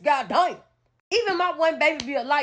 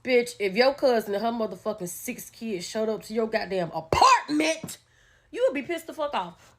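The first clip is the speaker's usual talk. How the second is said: ordinary delivery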